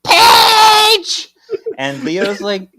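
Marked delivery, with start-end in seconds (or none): 0.1-1.3 piercing high, loud shriek; Piper. Acted. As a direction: shriek the name out at full pitch. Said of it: "Piper's shrieking voice".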